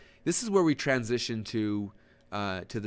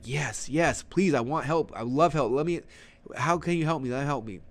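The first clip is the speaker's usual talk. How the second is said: higher, louder mock-eager begging; eager pleading; 3 semitones higher, 4 dB louder than usual. Webinar audience begging coach for help, imitated eager pleading. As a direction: plead like an over-keen fan, hammed-up.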